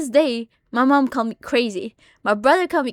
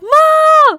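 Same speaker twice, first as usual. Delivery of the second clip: high, loud shriek for mom; screaming for mom; 13 dB louder than usual. Retold or imitated. Calling mom downstairs about a bug, panicked high scream. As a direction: shriek for help from upstairs. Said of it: "I screamed for my mom".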